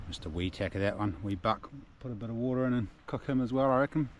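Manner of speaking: ordinary talk, nothing marked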